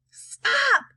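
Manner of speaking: high-pitched grandma yell; shrieky yell at storytelling volume. A narrator's reading. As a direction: yell it high, demanding a halt. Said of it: "yelled Grandma Josephine".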